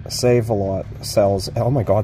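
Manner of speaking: ordinary talk, nothing marked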